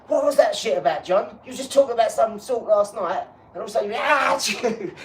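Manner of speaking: high, loud, laughing through the words. Pretend. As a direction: crack up while asking. Acted friend laughing while asking.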